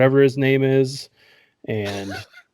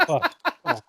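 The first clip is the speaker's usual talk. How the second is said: ordinary talk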